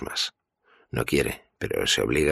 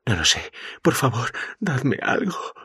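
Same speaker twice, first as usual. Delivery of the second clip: desperate begging; 13 semitones higher than usual. Acted. Mikey begging for drugs.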